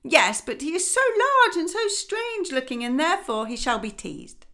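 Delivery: high, sneering nasty duck; spiteful duck. Acted. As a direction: pick on the odd one.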